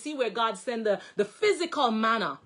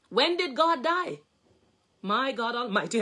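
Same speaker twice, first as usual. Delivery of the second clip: ordinary talk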